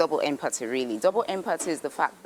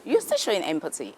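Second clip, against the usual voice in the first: ordinary delivery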